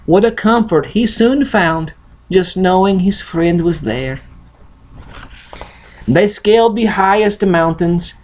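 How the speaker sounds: usual speaking voice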